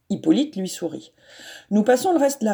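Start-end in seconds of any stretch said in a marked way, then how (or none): none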